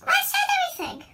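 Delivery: squeaky, very high-pitched voice; helium voice. Pretend. Sudden squeaky high line.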